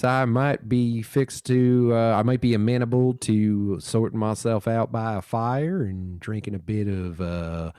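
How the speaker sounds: slow Southern gentleman's drawl; imitating a relaxed Southern storyteller by the fire, with a slow drawl. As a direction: stretch it out in a lazy Southern accent, like a breeze. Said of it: "a drawn out Southern, uh, drawl".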